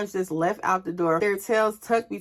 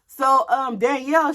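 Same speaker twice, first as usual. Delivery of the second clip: plain reading voice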